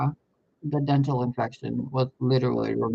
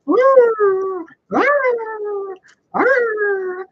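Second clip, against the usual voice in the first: high-pitched wailing yelps imitating the videos; mock tantrum wails; 5 dB louder, much slower than usual. Recalling her camera tantrums, imitating high wailing yelps. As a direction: throw the fake tantrum again, high and loud. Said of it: "and I'm like".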